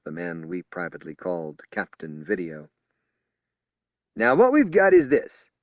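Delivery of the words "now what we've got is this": high, loud official announcing; strident briefing. Acted. Spokesman briefing assault personnel, loud and strident. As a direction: address the crowd like a press briefing. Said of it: "Anderson continued".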